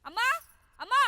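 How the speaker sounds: short yelled call for mother; sharp, rising shouted call; loud shouting. Acted. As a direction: holler for mum, impatient. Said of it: "ఇది ఇంత గట్టిగా అరుస్తోందంటే".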